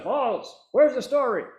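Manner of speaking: loud, high barked demand; demanding boss. Retold or imitated. Boss to late reporter, loud impatient demand. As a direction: imitate a boss calling out an employee.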